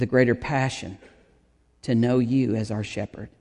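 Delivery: prayer. Acted. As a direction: pray gently over the people, trailing down to amen. Leads the congregation in prayer.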